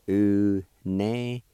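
U-ne-gil-ti split into slow, separate syllables; slow Cherokee demo. Shown as a demonstration. Teacher showing listeners each syllable, slowly spaced. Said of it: "be pronounced like this".

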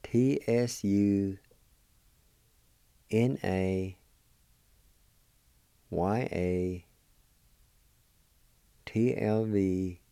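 letters spelled out slowly with pauses. Shown as a demonstration. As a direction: spell it letter by letter, drawing each out. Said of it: "Just spell like this".

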